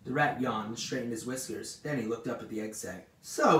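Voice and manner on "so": high, loud disgusted exclamation. A narrator's reading. Rat eyes the egg sac, disgusted. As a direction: throw the word out in disgust. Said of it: "he said in disgust".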